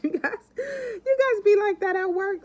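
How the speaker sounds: laughing loudly mid-sentence. Real, not acted. Vlogger laughing to her viewers about work breaks. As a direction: crack up while talking to viewers.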